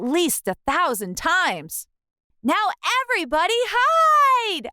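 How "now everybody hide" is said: scream. A narrator's reading. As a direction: warn everyone at full voice. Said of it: "I screamed".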